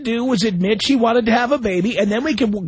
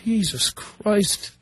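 exasperated sigh; 4 dB quieter, much slower than usual. Real, not acted. Host exasperated at caller.

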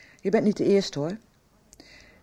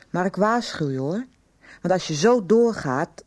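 ordinary delivery

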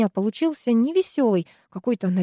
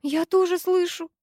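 thin, high-pitched scared bleat; frightened bleat; 10 semitones higher than usual. A narrator's reading. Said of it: "проблеяла Руднева".

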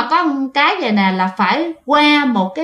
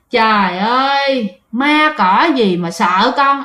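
put-on slow, drawn-out drunken bravado; acted drunk boasting, dragged out; drunk man; much slower than usual. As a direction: scoff like a tipsy man, stretching the words. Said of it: "Ổng xỉn nha các bạn, thì diễn tả lại y chang".

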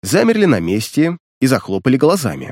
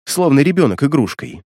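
ordinary delivery

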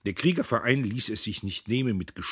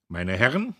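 speech opening; 3 semitones higher, 6 dB louder than usual. A narrator's reading. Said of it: "Dann begann er".

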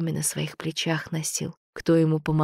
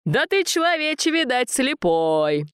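put-on teasing old god; 9 semitones higher, 3 dB louder than usual. A narrator's reading. God laughing at the smith.

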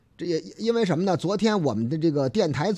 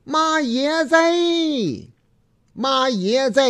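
put-on old Beijing auntie; 7 semitones higher, slower than usual. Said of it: "大婶子呀，上年岁的啊".

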